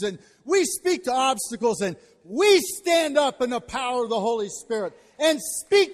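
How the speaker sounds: loud, high, building; fiery revival preaching; preaching at full force. Real, not acted. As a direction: rise to a shouted climax.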